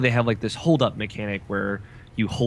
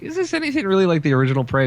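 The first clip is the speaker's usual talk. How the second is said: fast, high quoting doubtful fans; skeptical fans; 9 semitones higher, faster than usual. Retold or imitated. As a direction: rattle off a fan's worried question. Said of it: "a lot of people are saying".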